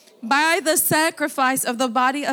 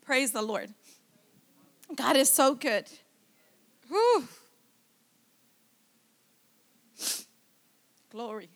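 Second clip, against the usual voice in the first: tearful; 6 dB quieter than usual. Real, not acted. Pastor overcome with tears.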